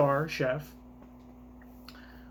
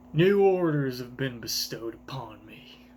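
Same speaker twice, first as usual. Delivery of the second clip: whisper; 4 semitones lower than usual. Acted. Voicing a character's line.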